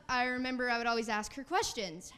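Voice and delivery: ordinary delivery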